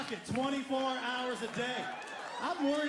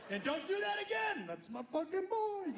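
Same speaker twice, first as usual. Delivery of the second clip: much higher, laughing through a fake scolding; proud mock scolding; 9 semitones higher than usual. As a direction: scold him while bursting with pride. Proud dad scolding son, laughing.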